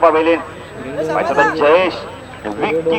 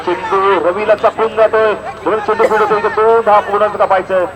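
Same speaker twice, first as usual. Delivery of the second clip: higher, rising commentary as the ball runs; excited call; 6 semitones higher than usual. Real, not acted. Commentator calling a two to the crowd, excited. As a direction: urge the batsmen on for two.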